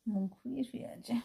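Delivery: whispering. Real, not acted. Whispering beside sleeping pet.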